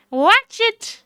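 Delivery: high Southern warning; Southern drawl. Acted. Touchy woman warns the lawyer off, Southern drawl. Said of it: "I've given her the one with the drawl".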